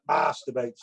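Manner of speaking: West Country accent. Shown as a demonstration. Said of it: "the best word to say in a West Country accent".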